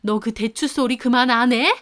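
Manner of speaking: mother-in-law yells at her daughter-in-law to quit the jujube remark; angry yell. A narrator's reading. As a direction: shout her down to stop the teasing. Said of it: "소리 지르셨고".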